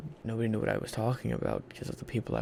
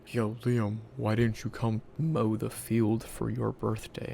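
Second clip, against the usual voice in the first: mock grandfather; 5 dB louder than usual. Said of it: "and it's like".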